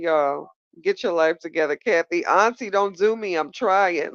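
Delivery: ordinary talk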